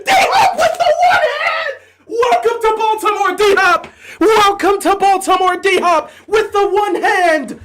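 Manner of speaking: real touchdown euphoria, shrieked; ecstatic screaming. Real, not acted. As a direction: lose it completely over the touchdown. Celebrating a touchdown.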